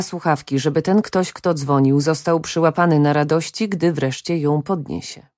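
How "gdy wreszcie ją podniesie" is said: plain reading voice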